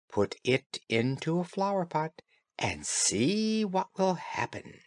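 high light fairy voice; tiny tinkling fairy voice. Acted. Fairy speaks.

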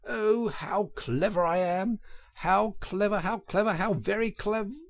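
high, giddy gloating; self-admiring Toad. A narrator's reading. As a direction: gush over your own cleverness, cut off abruptly. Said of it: "ecstasies of self-admiration".

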